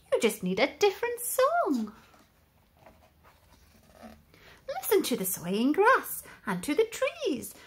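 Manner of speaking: a small wise friend consoling; gentle cricket. Acted. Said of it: "coughed a cricket".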